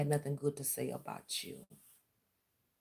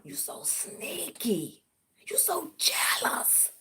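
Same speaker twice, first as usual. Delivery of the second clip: slow whisper, pitched up; whispered taunt; 8 semitones higher, much slower than usual. Real, not acted. Taunting her rival with a slow high whisper.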